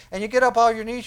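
usual speaking voice

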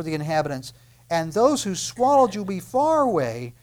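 loud, ringing scripture proclamation; preaching at full force. Acted. Preacher reading God's promise to the congregation, loud and ringing. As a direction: proclaim it to the back row.

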